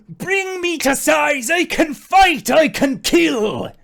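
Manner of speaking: high, slow full-voiced shout; battle cry. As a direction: bellow it up from the ground, every word drawn out. Elkhorn cries out from the ground.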